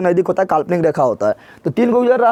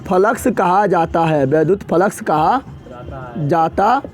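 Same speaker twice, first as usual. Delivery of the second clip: slow, broken dictation of a definition; slower than usual. Acted. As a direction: spell it out slowly for note-taking.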